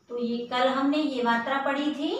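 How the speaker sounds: ordinary delivery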